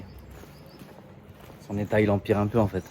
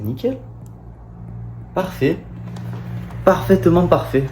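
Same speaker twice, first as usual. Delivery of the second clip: pleased; 8 semitones higher than usual. Real, not acted. Admiring himself in mirror.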